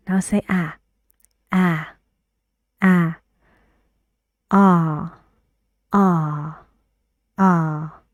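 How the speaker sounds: repeated ah with the back of the tongue pulled up; steady repetition drill. Shown as a demonstration. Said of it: "pull it up a little".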